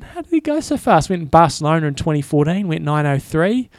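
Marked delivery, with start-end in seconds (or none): 0.0-1.1 much higher, amazed rhetorical question; baffled. Retold or imitated. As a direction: pitch it way up in wonder. Baffled thought about rival's time.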